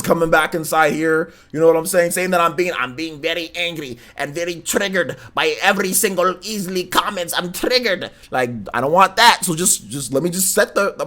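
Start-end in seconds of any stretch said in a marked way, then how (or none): 2.8-8.1 mock-angry in a put-on Russian accent; Russian accent, pretend anger. Streamer mocking claims he is triggered, Russian accent. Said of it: "use a Russian accent with the pretend to be angry".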